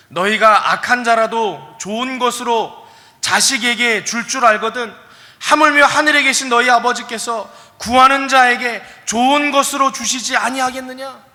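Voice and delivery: loud, high and slow proclaiming of the verse; preaching scripture. Acted. Preacher proclaiming Jesus' words to the church, full force. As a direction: thunder the verse out to the congregation.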